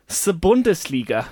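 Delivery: Bundesliga with German u and hard d; the Bundesliga, German accent. Shown as a demonstration. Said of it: "said in a German accent".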